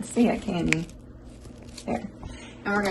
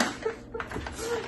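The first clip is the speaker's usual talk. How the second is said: high squeaky laugh; 10 dB quieter than usual. Real, not acted. Laughs about crying reasons.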